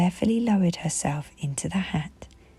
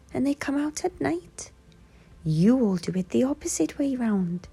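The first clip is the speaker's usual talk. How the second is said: higher, kindly little owl; Hoot the owl; 7 semitones higher than usual. A narrator's reading. Hoot telling the toys about owls, higher and kind. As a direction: gently explain like a polite small owl.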